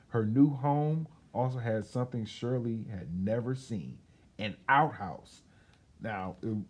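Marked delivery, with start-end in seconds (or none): 0.1-5.4 put-on lively storybook reading for children; story narration. A narrator's reading. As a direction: tell it like a bedtime story to kids. Reads picture book aloud.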